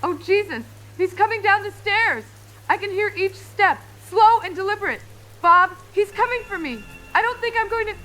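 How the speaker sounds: high, loud, terrified voice; rising panic. Acted. Trapped caller tells host he's coming down the stairs, panicked. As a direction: panic mounting until cut off. Killer comes downstairs toward caller.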